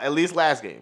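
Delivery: usual speaking voice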